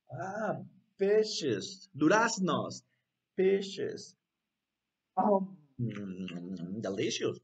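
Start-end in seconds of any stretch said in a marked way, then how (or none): 5.2-7.4 low, long mm of pleasure; yummy tasting reaction; savouring. Pretend. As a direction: pretend to relish a tasty bite. Pretends to taste peaches.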